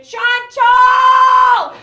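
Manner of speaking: loud, high, sung-out demonic call; mother's demonic summons. Retold or imitated. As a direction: howl her name like a possessed mother. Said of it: "in this demonic voice call my name".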